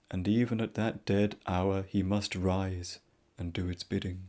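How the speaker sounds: ordinary delivery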